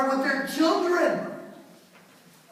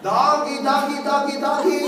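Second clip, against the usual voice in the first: loud, higher, sing-song calling; calling a dog; 4 semitones higher, 6 dB louder than usual. Retold or imitated. Man outside at night to neighbor's dog, loud calling. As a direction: holler for the doggy on a level note.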